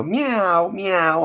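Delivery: people faking a cat meow; a lightly voiced cat imitation; mocking a fake meow. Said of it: "try to copy a cat sound".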